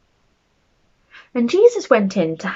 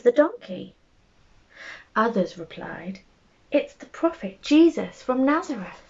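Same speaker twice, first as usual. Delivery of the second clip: lively, animated storybook narration, rising higher as the crowd grows; bedtime story reading; 3 semitones higher than usual. A narrator's reading. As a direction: read it like a picture book to small children. Reads a bedtime story aloud.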